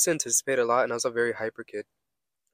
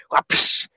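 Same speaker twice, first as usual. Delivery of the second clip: slap sound; 9 dB louder than usual. Retold or imitated.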